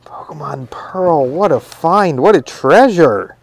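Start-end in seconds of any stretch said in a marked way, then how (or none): 0.0-1.2 slow awed whisper. Real, not acted. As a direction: breathe the name out in awe. Awed at a found game.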